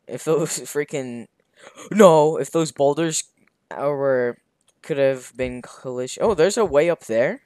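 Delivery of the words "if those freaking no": ordinary talk, nothing marked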